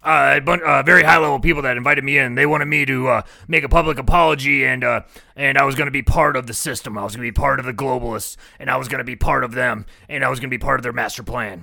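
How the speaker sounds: loud mock rant in his voice; pushed-out, self-important retelling; retold rant. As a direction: imitate a man holding forth, loudly. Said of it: "He goes".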